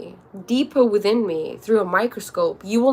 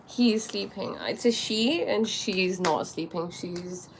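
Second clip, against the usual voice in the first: normal talking voice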